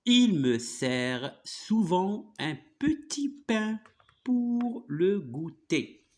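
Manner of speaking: slow, drawn-out dictation-style reading; model reading aloud. Shown as a demonstration. Teacher reading a short story for a pupil, slow careful model reading. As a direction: read it out very slowly, phrase by phrase.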